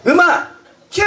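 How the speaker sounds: high, loud shout of the cue; cue call. Real, not acted. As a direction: call the band in. Calls cue to band.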